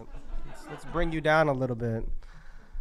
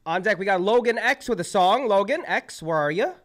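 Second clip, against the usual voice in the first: higher and louder, calling the next singer up; hype announcer call; 7 semitones higher, 7 dB louder than usual. Real, not acted. Emcee calling [name] to the stage, loud and hyped. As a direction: shout the name out to the room. Host calls the next act.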